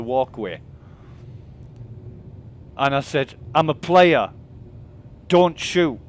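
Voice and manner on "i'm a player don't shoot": retold call-out. Calling out to other player.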